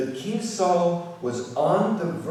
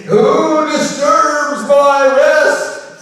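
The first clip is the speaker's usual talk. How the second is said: slow, loud, booming spirit voice; ghostly voice; 11 semitones higher, 16 dB louder, much slower than usual. Acted. Spirit of Samuel rises from the underworld, slow booming voice. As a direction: boom it out like a disturbed ghost.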